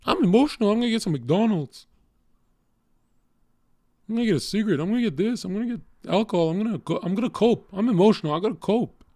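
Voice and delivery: mock him in a high, needy whine; mocking an emotional guy. Streamer mocks an emotional man who copes with junk food, high whiny mock voice. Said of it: "You're emotional, you have tits".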